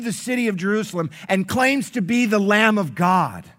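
high, loud, ringing sermon delivery; full-force preaching. Acted. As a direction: proclaim it to the whole congregation.

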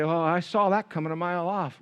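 lightly voiced smug churchgoer; mock smug. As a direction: mimic the smug gossip.

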